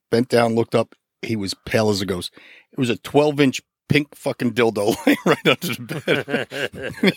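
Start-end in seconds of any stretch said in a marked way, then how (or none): 2.7-7.1 slow punchline breaking into laughter; laughing while talking. Real, not acted. Officer delivering his story's punchline, laughing while talking. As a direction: crack up partway through the reveal.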